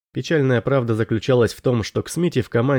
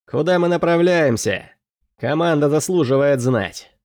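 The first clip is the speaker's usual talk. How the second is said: higher, insistent questioning; demanding; 4 semitones higher than usual. A narrator's reading. New quartermaster catching up with Kelin, demanding. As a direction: press for an answer in a raised pitch. Smiti pursues and challenges the captain.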